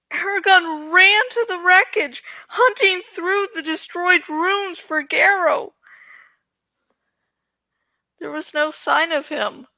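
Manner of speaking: lively read-aloud storytelling; story narration. A narrator's reading. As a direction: narrate the adventure with drama. Reads novel aloud.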